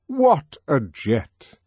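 slow, loud wonder; excited exclaim. Acted. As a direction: marvel at it slowly and loudly. Controller exclaims.

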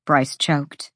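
plain reading voice

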